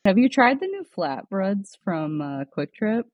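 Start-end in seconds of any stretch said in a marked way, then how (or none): none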